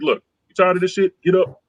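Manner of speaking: ordinary talk, nothing marked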